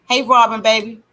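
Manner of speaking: usual speaking voice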